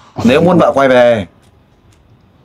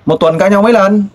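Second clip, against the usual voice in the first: ngạc nhiên; 8 semitones higher than usual. Real, not acted. Host shocked at frequent quarrels.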